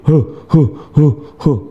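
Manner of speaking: rounded "huh" blowing, as a wrong example; wrong spicy puffing. Shown as a demonstration. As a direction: blow quick rounded huh puffs. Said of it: "bukan begini". (tiupan "huh" contoh yang salah)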